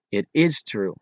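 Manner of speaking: "is" emphasized to affirm; stress on IS. Shown as a demonstration. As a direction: lean on the word "is". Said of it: "put an extra strong emphasis".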